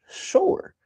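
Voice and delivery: squeaky high mock reply; sarcastic sure. Real, not acted. Streamer answering his own question with a high mock sure. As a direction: throw it away in a silly high voice.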